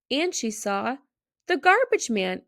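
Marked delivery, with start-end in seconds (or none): 1.5-2.4 high, eager exclamation; exclaimed. A narrator's reading. Girl spotting a helper, calling out excitedly. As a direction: call it out like a kid spotting something. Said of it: "Isabel exclaimed".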